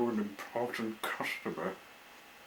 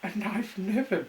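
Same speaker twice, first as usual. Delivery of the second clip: very high, loud, wailing tearful voice; breaking into a cry; near tears; 13 semitones higher, 6 dB louder than usual. Acted. The engine wails to her friends, high and tearful. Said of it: "She started to cry".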